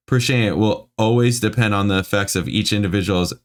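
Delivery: normal talking voice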